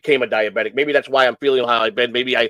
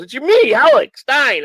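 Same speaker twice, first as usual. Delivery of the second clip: pitched up high and a bit louder, teasing; playful Alex Stein; 12 semitones higher, 4 dB louder, slower than usual. Retold or imitated. Alex Stein texting Joey out of the blue, high and playful. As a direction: announce yourself like a cheeky celebrity caller. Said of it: "He goes".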